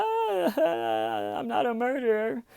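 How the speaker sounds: very high, whimpering mock sob; fake crying. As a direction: badly fake a tearful denial in a squeaky-high voice. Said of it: "having to fake the".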